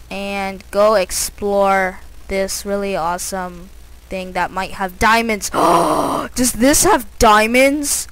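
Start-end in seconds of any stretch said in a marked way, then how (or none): 5.5-8.1 gasping, higher and louder with wonder; slow, breathless hopeful wonder; gasp of surprise. Real, not acted. Gamer spotting possible diamonds, gasping high and loud.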